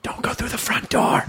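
forceful stage whisper; whispered warning. Pretend. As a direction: warn her urgently in a whisper. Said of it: "try to warn her by whispering".

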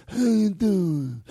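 slow, drawn-out mobster voice; mafia greeting. Shown as a demonstration. Host showing the mob voice he wants, slow. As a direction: stretch it like a mock mafioso. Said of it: "what I'm trying to do".

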